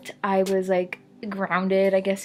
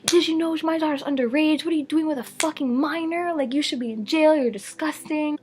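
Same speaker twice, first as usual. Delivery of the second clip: retold mom going off; 7 semitones higher than usual. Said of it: "starts going off on this guy".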